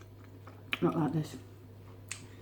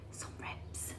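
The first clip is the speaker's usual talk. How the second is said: whispering; 16 dB quieter than usual. Real, not acted. Whispered reveal of food.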